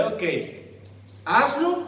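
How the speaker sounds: a dad daring his son to disobey; father's warning. Pretend. Papá advirtiendo a Dieguito, un reto fuerte y alto.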